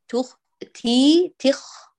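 تخ drawn out with friction in the throat; isolated kha demo. Shown as a demonstration. Teacher isolating the kha for the student, slow and deliberate. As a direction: rasp the خ in تخ slowly. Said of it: "سامعين صوت الخاء؟".

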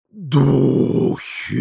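long, hoarse spooky exclamation; wheezing hoarse cry. A narrator's reading. Forgotten Madame calls from the corridor, wheezing. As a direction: wheeze it out, stretching each vowel. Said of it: "просипела из коридора всеми забытая мадам Жаме".

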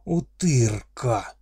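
loud gruff orc bellow; angry orc roar. Acted. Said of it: "я услышала гневное".

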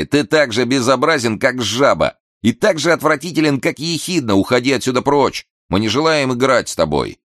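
children mocking an ugly boy; mocking taunt. A narrator's reading. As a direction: taunt him like a gang of kids. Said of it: "начали смеяться и сказали".

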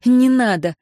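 резко и громко, выше; огрызнуться, отталкивая его; огрызнулась. A narrator's reading. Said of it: "огрызнулась она". (резко огрызнуться громко)

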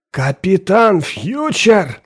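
slow, high, stunned exclamation; astonished. Acted. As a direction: gasp it out in disbelief. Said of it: "вытаращил глаза профессор".

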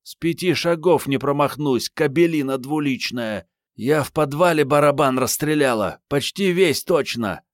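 high, scolding woman's tirade; indignant, high-pitched menacing voice; angry threat. A narrator's reading. Armed woman threatens the cheating man.

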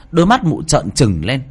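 usual speaking voice

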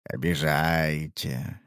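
low, slow oily villain; slimy dealer voice. Acted. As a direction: drawl it low like a sly crook. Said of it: "dealer voiced in story".

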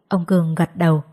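ordinary delivery

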